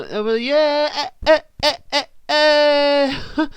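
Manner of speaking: high, loud hammed-up wailing sobs; mock crying. Voicing the sad girl sound, hammed-up loud crying. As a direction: fake a loud wailing cry. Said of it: "Some crying there".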